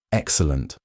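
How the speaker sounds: low, smooth and unbothered; smoothly satisfied, no hurry. Acted. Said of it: "Noel said smoothly".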